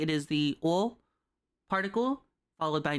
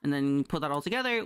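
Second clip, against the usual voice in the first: ordinary talk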